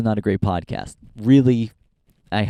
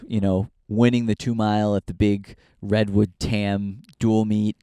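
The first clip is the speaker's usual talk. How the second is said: ordinary delivery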